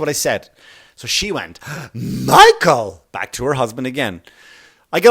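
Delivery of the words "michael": shocked gasp, loud appeal; scandalized gasp and cry. Retold or imitated. Wife shocked calls husband.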